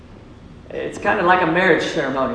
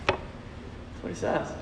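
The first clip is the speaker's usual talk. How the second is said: whispered aside; 16 dB quieter, slower than usual. Acted. Aside after quoting Jesus.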